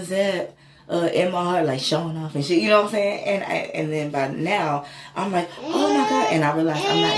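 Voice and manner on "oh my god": high, louder, crying out; overwhelmed as the pain leaves; tearful awe. Retold or imitated. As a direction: break into tears of wonder. Retelling pain vanishing, tearful.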